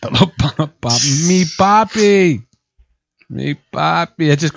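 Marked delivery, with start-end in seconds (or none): 0.0-0.7 lightly voiced Spanish parrot; Spanish-accented bird. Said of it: "the bird had a Spanish accent". 1.3-4.3 slow, stretched mock-Spanish squawk; Spanish-accented parrot. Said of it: "the bird had a Spanish accent".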